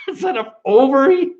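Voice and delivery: words bursting into a laugh; can't hold it in, laughing shout. Real, not acted. Bursting out at a pun.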